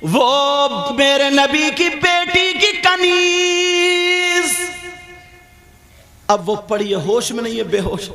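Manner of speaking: impassioned religious storytelling; fervent preaching. A narrator's reading. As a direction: preach the story at full force to the crowd.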